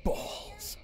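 high, breathy whispered word; naughty whisper. Acted. Streamer saying a rude word to chat with mods gone, whispered.

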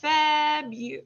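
"Febu-" stretched out and cut off; drawn-out first syllables of "Febu-"; slow. Shown as a demonstration. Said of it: "oh, we'll say it slow".

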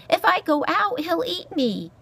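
high, pleading mouse voice. A narrator's reading. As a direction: plead as a small frightened mouse. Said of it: "Poppy pleaded".

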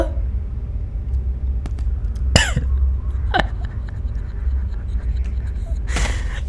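long, high, airy unvoiced laugh; breathless laugh. Real, not acted. Streamer laughing off his fright, airy high laugh.

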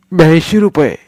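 a fibbing boy playing innocent; boy Sonya. Boy Sonya lying to grandmother for money, eager.

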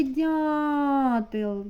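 "дятел" with a long held А after Д; виділений звук а. Shown as a demonstration. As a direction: stretch the А in "дятел". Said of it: "а". (протяжне А у слові "дятел")

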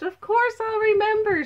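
very high, loud, merry protest; merry assurance. A narrator's reading. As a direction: insist cheerfully, voice soaring. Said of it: "Nata laughed".

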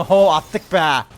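slow, loud words through laughter; laughing triumph. Real, not acted. Gamer celebrating the kill with viewers, loud laughing cheer. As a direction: laugh it out in victory.

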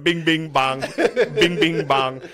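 mock full-volume sound bite; Trump. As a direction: chant it like a looping clip, a bit loud. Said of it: "it was just Trump on full volume going".

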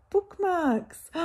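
high and loud, thrilled; delighted squeal. Real, not acted. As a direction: squeal at the surprise. Finding bookmarks in parcel.

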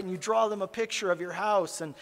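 ordinary talk

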